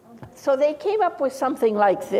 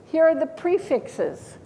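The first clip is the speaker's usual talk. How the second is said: ordinary talk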